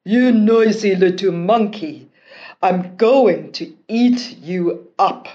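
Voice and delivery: loud, threatening monster voice; annoyed monster. Acted. Annoyed monster threatening the pestering prince, loud menace. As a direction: growl a threat at the noisy boy. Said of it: "The monster was annoyed".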